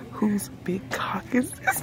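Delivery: unvoiced, slow, hushed whisper. Whispering a line from a book to her friend in a bookstore. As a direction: breathe it out so nobody nearby hears. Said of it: "can they hear me if I whisper".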